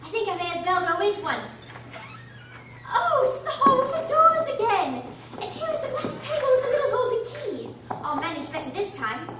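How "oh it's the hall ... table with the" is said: much higher, louder delighted cry; surprised. Acted. Alice finds the familiar hall again, excited cry. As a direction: burst out in glad recognition.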